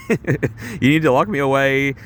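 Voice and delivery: normal talking voice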